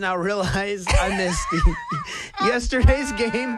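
real laughter breaking into the words; slow, dragged-out admission through laughter; laughing realization. Real, not acted. Player confesses to coworkers he missed another game, laughing.

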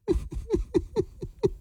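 a high, soft, slightly manly sleep laugh; imitating girlfriend's laugh. [name] mimics his girlfriend laughing in her sleep, high soft chuckle. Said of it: "Are you doing a good imitation of her?".

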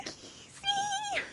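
whisper. Pretend. Echoed in a whisper.

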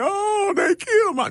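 high, shouted mock wail of a grieving parent. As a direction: wail it like a parent playing the victim. Parent playing victim after shooting.

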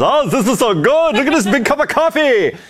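high, mock European accent; light mock European accent; imitating European tourists. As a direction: gush like a tourist abroad. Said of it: "all these people with the accents".

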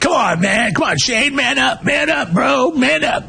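mock yell. Big brother telling crying Shane to man up, mock yelling. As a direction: egg him on like a pushy big brother. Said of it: "but back then I'm like".

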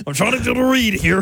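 Ben impression. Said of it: "That's a pretty good Ben impression".

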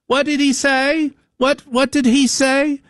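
very high, slow shrill-free mock wife voice; suspicious wife. Comedian mimicking a wife asking what the stranger said, very high. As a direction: squeak it like a nosy wife. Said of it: "She goes".

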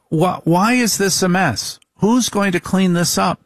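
high, accusing wife voice; nagging wife. Retold or imitated. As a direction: play the wife demanding answers. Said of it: "And then it goes to".

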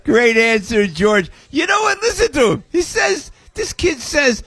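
high, bursting with delight; excited gushing. Real, not acted. Host gushing over teen singer.

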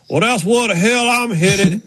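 high, drawn-out driver impression; Ward Burton. Driver heading off for the cooler, high slow impression. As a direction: imitate him slowly, pitched well up. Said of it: "Ward." He goes".

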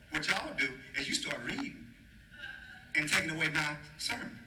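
low, confiding mock reprimand; mock scolding. Preacher telling members off for reading ahead, mock scolding. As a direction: drop low and chide them playfully.

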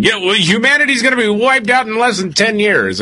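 high-pitched mock panic; mock doomsaying. Host caricatures the doomsday view of AI, mock-alarmed.

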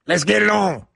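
a hint of a referee's call; Mills Lane impression. Co-host asked for his boxing referee bit, lightly put-on referee call. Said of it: "do your Mills Lane".

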